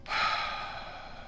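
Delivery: long unvoiced exasperated sigh; weary sigh. Real, not acted. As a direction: breathe out in frustration. Sigh after mistake.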